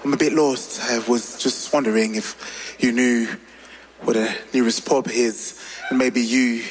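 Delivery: low, smooth British-style pickup accent; changed English accent. Shown as a demonstration. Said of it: "si je change juste un peu mon accent".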